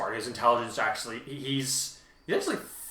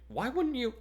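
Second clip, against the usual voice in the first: pitched way up in disbelief; gaping surprise; 13 semitones higher than usual. A narrator's reading. Girl stunned by her partner's choice, a high gasping question. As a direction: Yaoyorozu asks Kurdai in shock, high surprised question. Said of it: "she gaped".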